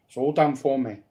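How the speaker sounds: ordinary delivery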